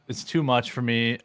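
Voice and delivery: ordinary talk, nothing marked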